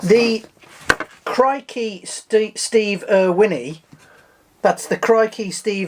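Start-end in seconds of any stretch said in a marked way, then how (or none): 0.0-3.8 slow announcement. Real, not acted. Announcing silly snail name, slowly.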